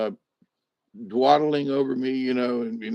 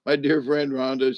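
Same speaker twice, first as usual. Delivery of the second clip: ordinary talk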